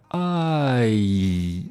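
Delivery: "I" stretched, gliding from ah to ee; long, gradual "I"; full gliding diphthong. Shown as a demonstration. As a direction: draw out the whole glide in "I". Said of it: "一定要把那个过程I 读出来".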